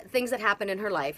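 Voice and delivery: usual speaking voice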